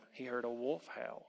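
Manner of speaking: ordinary delivery, nothing marked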